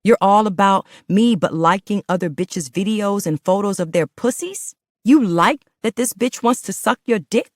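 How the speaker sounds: loud high screaming accusation. Acted. Woman screaming at her partner over his phone. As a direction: scream it right in his face. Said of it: "I screamed in his face".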